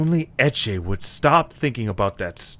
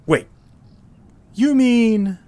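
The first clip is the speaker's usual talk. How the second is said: dawning shock; 11 semitones higher, 6 dB louder, slower than usual. Acted. Eche realizes the truth.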